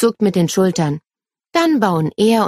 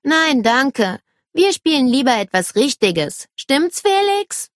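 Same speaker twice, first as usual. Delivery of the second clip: dismissive; 7 semitones higher than usual. A narrator's reading. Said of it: "David schnauft".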